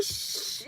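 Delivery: soft, voiceless shush; mock library shush. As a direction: hush someone like in a library. Mocks being hushed in a library.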